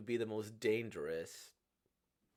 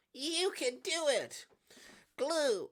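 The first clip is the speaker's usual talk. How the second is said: loud, high-pitched encouragement; acted pep-squad shout; rousing cheer; 6 dB louder, slower than usual. Character cheers the friend on.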